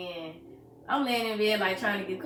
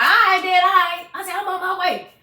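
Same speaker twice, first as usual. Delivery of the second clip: loud, high, hurried-off reply; retold distraught outburst; agitated urgency; 10 semitones higher, 10 dB louder than usual. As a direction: cut the father off at raised pitch and volume. Retelling agitated reply, pacing.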